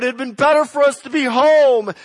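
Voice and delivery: loud, high, desperate cry. Retold or imitated. Israelites at the Red Sea crying to go home, loud. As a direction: wail it like trapped people. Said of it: "they're crying out".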